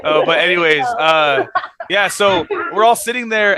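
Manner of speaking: ordinary talk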